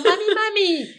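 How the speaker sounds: put-on high whiny kid calling for mommy; mimicked child calling. Mother mimics her kids calling out for her, high and whiny. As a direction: imitate the kids pestering her.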